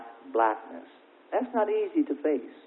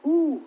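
1 whoop; 11 semitones higher, 4 dB louder than usual. Real, not acted. Preacher exclaims mid-sermon.

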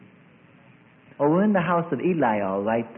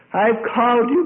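raised, high acted summons; calling out; 10 semitones higher, 8 dB louder than usual. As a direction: proclaim the call loudly. God's call to Samuel, acted.

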